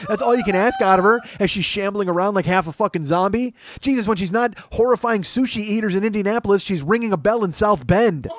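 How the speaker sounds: usual speaking voice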